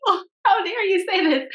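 high-pitched mock outrage; hammed-up inner protest, high and indignant; mock indignation. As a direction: play scandalized, pitched well up. Acted inner outrage at voter's remark.

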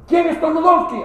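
mock Russian sergeant. Sergeant asks who sneezed.

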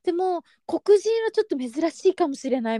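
polite, high-pitched staff explanation; shop-assistant voice. Retold or imitated. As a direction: imitate a clerk explaining to a customer. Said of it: "店員さんみたいな感じの".

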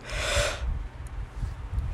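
long open yawn. Real, not acted. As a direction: let a big yawn out. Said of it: "Excuse me, I'm so sorry".

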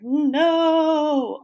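high, loud squeaky fake-crying no; mock crying wail. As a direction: squeal it like a stuck spray bottle. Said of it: "Windex bottle gets stuck".

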